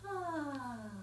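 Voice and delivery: high, falling dreamy "ah"; long adoring sigh; lovely sigh. Shown as a demonstration. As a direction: sigh for someone lovely and kind. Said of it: "and you go".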